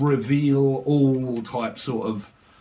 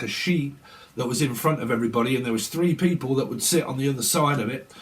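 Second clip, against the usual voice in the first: normal talking voice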